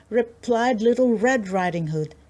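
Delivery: ordinary delivery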